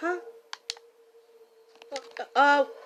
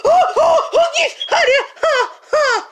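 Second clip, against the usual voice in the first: high, loud shouts through laughter; laughing shout; 10 semitones higher, 13 dB louder than usual. Real, not acted. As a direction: yell with delight, laughing.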